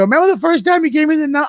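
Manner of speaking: high, rushed babble; acted-out flustered over-explaining; retold panic. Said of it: "I panicked".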